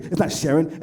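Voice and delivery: ordinary delivery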